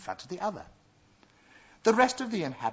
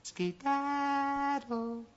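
high, slow, drawn-out dismissal; cartoon princess; much slower than usual. A narrator's reading. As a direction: stretch it out, shooing him away.

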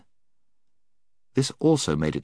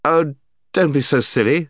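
exasperated; 8 semitones higher, 6 dB louder than usual. Acted. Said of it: "said Scrub".

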